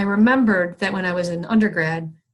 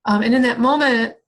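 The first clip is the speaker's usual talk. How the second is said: ordinary talk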